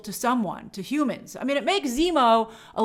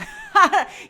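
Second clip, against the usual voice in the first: short laugh; 4 dB louder than usual. Real, not acted. Laughing at "not idiots.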